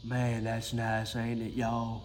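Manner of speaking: low, soft, slow Mississippi drawl; Mississippi accent. Shown as a demonstration. Man showing viewers his gear, low Mississippi accent. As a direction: lean into a Mississippi accent, pitched low and quiet. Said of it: "That's my Mississippi accent".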